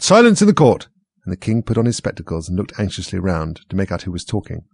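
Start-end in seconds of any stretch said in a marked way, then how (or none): 0.0-0.9 high loud command; loud high call for silence; cried out. A narrator's reading.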